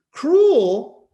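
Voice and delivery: high, loud indignant echo; outraged echo. Acted. Wife repeating his word, high and loud. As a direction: fling the word back in disbelief.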